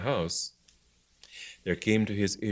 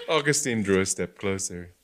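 usual speaking voice

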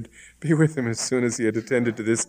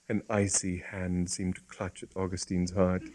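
ordinary delivery

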